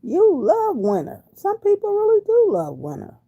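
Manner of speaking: high, loud, delighted greeting; light up as you read her name; gushing over a viewer's comment; excited. Real, not acted.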